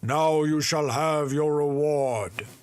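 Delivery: high, loud, slow spirit proclaiming; grand slow spirit voice; giant spirit voice. Acted. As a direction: boom it out slowly like a towering spirit. Spirit grown giant speaks.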